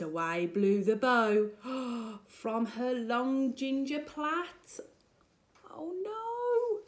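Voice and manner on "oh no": high, sing-song oh no; playful gasp of dismay; mock dismay. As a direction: pretend alarm for the children. Reader reacts to lost bow.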